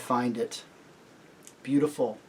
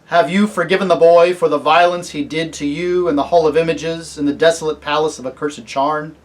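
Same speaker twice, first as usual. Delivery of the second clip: louder, grave questioning; solemn kingly lion; 3 semitones higher, 9 dB louder than usual. Acted. Aslan asking Polly about forgiving the boy, solemn. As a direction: ask with weighty authority.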